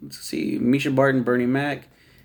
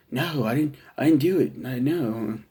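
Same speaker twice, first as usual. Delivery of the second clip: a loose, half-hearted Bam impression; mock nervous denial; bad Bam Margera. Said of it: "It's a really bad Bam Margera impression".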